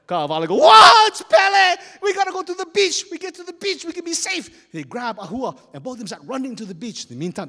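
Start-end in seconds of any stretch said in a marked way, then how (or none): 0.6-4.7 panicked shout. Acted. Chief panicking at lava.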